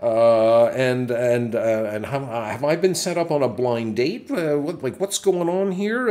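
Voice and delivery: reliving the shock of a surprise setup; high, loud incredulous inner monologue; incredulous panic. Retold or imitated. Retelling shocked inner thoughts.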